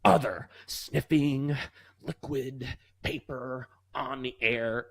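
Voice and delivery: very slow, puzzled reading; baffled principal. Retold or imitated. Principal filling out the detention slip, slow puzzled reading. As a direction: drag it out like reading an absurd form box. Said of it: "He's like".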